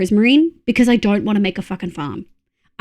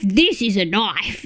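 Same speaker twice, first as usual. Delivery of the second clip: Australian movie quote; 3 semitones lower, 3 dB quieter, slower than usual. Retold or imitated. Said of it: "Reference to that".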